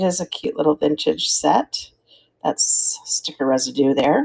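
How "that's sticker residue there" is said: whispered aside. Real, not acted. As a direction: whisper it to yourself. Aside while inspecting item.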